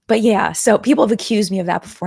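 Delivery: ordinary delivery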